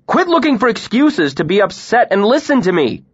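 high, loud angry snap; loud, sharp order; snapped. A narrator's reading. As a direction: cut her off hard. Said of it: "I snapped".

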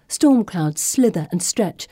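ordinary delivery

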